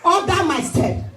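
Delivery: high, fervent sermon call; preaching at full force. Acted. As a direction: rouse the church to repeat after you. Preacher exhorting congregation.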